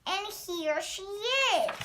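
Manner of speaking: high, loud, slow little bear voice; Baby Bear crying out. A narrator's reading. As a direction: announce the discovery in a drawn-out wail. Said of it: "cried the Baby Bear".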